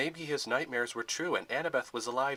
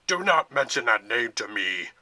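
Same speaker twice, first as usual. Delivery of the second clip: loud menacing growl; 9 dB louder than usual. A narrator's reading. General to Thorn, a loud growled warning. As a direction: snarl it as a warning. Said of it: "the man in the shadows growled".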